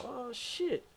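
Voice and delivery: high, breathy startled oh shit; alarmed gasp. Retold or imitated. Realizing he is speeding up.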